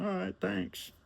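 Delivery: high, breezy rich man; high client voice. Retold or imitated. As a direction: pitch way up and wave it off. John voiced signing off.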